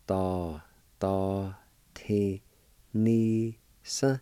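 "Da, da, ti, ni, sa" as separate, spaced syllables. Shown as a demonstration. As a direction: sound out "Da, da, ti, ni, sa" slowly. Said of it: "It's pronounced like this".

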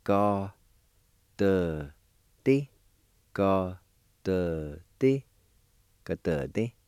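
Ga, de, di, ga, te, te said apart with pauses; Cherokee syllable by syllable. Shown as a demonstration. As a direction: break the word into slow separate syllables. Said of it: "it's pronounced like this".

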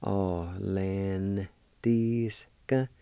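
"Atlantiska" stretched out very slowly with a rise. Shown as a demonstration. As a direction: draw out "Atlantiska" for learners. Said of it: "like this".

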